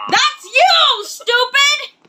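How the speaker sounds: high, loud shout back at the screen; loud retort. Real, not acted. Streamer shouting back at the storybook narrator, loud and high. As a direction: yell it right back, half laughing. Story asks the kid to roar.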